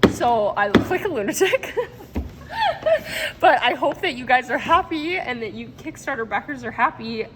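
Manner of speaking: talking through real giggles; giddy, laughing through the words. Real, not acted. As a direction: laugh at yourself while still getting the words out. Laughing at herself on camera.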